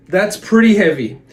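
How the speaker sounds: ordinary talk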